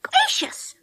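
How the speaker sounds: startled gasp of a word, pitched high; high alarmed exclamation. A narrator's reading. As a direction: jump at the thought.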